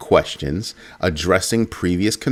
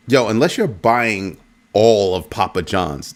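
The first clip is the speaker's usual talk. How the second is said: normal talking voice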